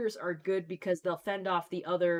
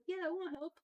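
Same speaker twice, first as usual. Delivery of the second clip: put-on childlike voice; 11 semitones higher, 5 dB quieter than usual. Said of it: "And the girl was like".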